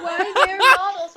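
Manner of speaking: lightly hammed-up dim confusion; Derek Zoolander. Mocking a client as a clueless movie model, puzzled impression. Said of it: "like talking to Derek Zoolander".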